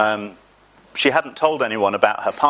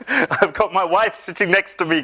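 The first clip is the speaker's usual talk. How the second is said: higher-pitched comic protest through laughter; protesting to God; 7 semitones higher than usual. Acted. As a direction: plead your excuse, half laughing. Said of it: "I, I said to God".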